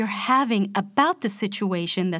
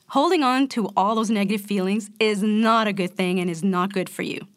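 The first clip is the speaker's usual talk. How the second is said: ordinary talk, nothing marked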